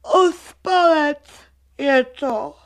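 high, loud, drawn-out yawn through the words. Acted. Vochomůrka agreeing about the lazy seed, mid-yawn. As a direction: stretch the words into a big yawn. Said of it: "Zívl si Vochomůrka".

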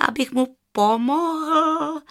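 high, drawn-out incredulous musing; slow, high jackal astonishment; amazed jackal. A narrator's reading. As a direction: wonder aloud, slow and high, stretching the last word. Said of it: "pomyslel si s údivem šakal".

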